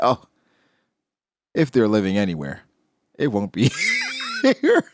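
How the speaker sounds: cracking into laughter on here; cold line broken by a laugh; laughing villain line. Retold or imitated. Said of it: "He said".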